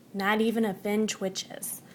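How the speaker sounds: ordinary delivery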